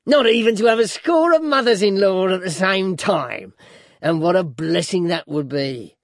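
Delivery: high and loud, easing down at the end; gloating dwarf, heavily sarcastic; grinning dwarf's sarcasm. A narrator's reading. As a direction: taunt her with mock rapture. Voices dwarf Quilp in reading.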